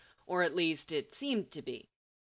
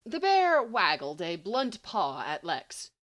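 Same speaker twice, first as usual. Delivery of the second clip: ordinary delivery, nothing marked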